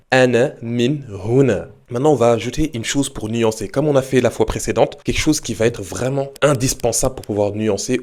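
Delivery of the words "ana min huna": Ana min huna said slowly and clearly; slow model repeat. Shown as a demonstration. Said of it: "tu peux lui répondre". (répétition lente du modèle)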